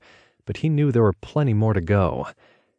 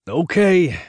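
raised, higher-pitched call; shouted; 6 semitones higher, 4 dB louder than usual. A narrator's reading. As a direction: call it out to the ranch hands. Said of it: "Rick shouted".

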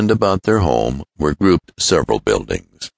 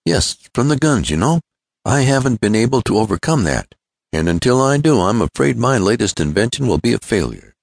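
worried inventor, lightly voiced, higher; lightly acted troubled admission to a chum; Tom; 9 semitones higher than usual. Tom admits his problem.

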